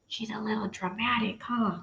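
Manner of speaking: very soft teasing remark; wry aside. Real, not acted. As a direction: lean in and tease.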